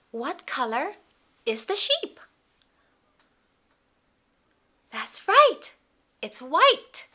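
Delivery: put-on high, loud, singsong toddler talk; reading to a toddler. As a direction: beam at a little child and coo. Talks to toddlers, animal sounds.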